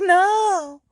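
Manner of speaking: a high, stretched cry of horror; a dramatized gasp of disbelief; mock horrified no. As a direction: react as if watching him fall overboard. Wife sees husband go overboard.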